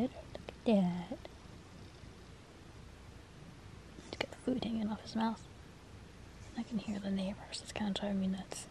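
hushed whisper near the cat. Real, not acted. Owner whispering about the cat beside her, hushed whisper. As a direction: whisper so the cat stays settled.

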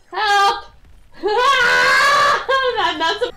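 high, loud cry for help; shouted plea. Real, not acted. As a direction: yell for help, half laughing.

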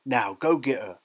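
loud, forceful command; commanding order. A narrator's reading. Bully sends smaller boy to fetch the swan, commanding. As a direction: order him about firmly. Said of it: "he ordered".

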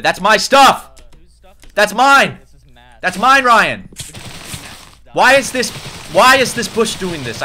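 loud whiny protest over his stuff; possessive whining. Real, not acted. Friend takes his dropped items.

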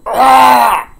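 high, loud panicked scream; retold terrified yell; scream of terror. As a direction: scream as the trophy swings. Trophy swung at his head.

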